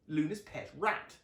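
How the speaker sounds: plain reading voice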